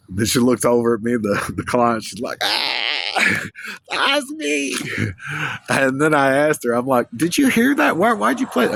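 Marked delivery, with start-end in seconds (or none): 2.4-4.7 high, gushing cry of recognition; delighted squeal. Retold or imitated. Client squealing that the song is hers, delighted and high. Voicing the client's excited reply. 7.2-8.7 much higher, astonished questioning; incredulous. Retold or imitated. Asking the client why she played that song, astonished and high.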